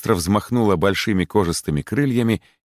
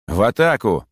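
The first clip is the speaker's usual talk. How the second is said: higher-pitched battle cry; rallying call; 4 semitones higher than usual. A narrator's reading. Michael urges the bronze beetle into battle, rallying call. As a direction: call the troops to charge. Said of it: "с призывом".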